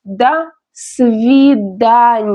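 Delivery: very slow, spaced До свидания; repeat-after-me model. Shown as a demonstration. Teacher has learners repeat До свидания, very slowly. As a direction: stretch every syllable of До свидания. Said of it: "Repeat after me".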